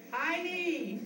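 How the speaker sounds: a sudden high call across the room. Real, not acted. Speaker calls out to Heidi during speech, high loud call. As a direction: call her name out loud and bright. Calling out to someone.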